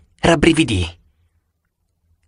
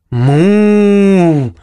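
moo; 3 semitones higher, 3 dB louder than usual. Acted. Cow serpent speaks.

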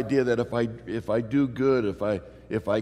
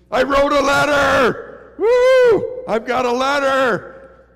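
much higher and louder, slow, whooping with joy; jubilant shout; 14 semitones higher, 12 dB louder, slower than usual. Acted. Preacher reliving his friend's conversion, whooping for joy. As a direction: cry out the good news like a victory.

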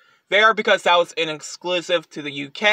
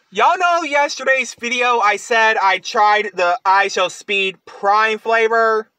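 ordinary talk

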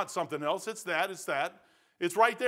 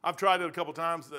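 ordinary talk

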